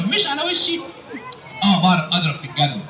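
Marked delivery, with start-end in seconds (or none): 1.6-2.8 very low puppet character voice; Aragoz puppet. Acted. Puppet telling the host his school subjects, Aragoz voice. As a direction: list it as a puppet, far below your own pitch. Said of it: "الأراجوز ده وهو بيرغي".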